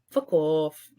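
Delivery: deadpan mock brush-off; understated, unimpressed impression of a friend; imitated straight-faced dismissal. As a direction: dismiss them with a straight face. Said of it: "he'd just be there straight face".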